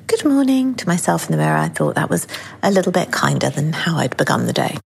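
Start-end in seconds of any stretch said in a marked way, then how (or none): 0.1-0.7 higher, cheery morning greeting; bright greeting. Retold or imitated. Smiling at herself in the mirror, a cheery greeting. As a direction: greet yourself kindly like a friend. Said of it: "and said".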